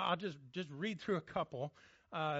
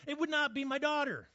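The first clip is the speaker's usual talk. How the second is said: higher and louder, firmly refusing; emphatic protest; 9 semitones higher, 6 dB louder than usual. Real, not acted. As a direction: declare it would never be your daughter.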